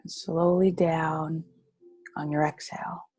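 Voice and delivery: slow, drawn-out breathing cues; guided meditation. Acted. Coach cues raising the hand with the breath, in a slow guided-meditation voice. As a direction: pace each phrase to a long breath.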